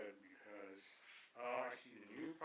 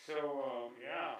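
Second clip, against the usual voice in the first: talking around a paintbrush; mouth full; 3 semitones higher, 13 dB louder, slower than usual. Real, not acted. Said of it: "I have a paintbrush in my mouth".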